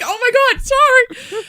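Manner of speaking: squealed, high and loud oh my God; a shocked, high-pitched squeal of sorry; high mock apology. After hitting his shoulder.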